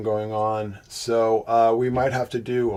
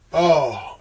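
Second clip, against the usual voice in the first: usual speaking voice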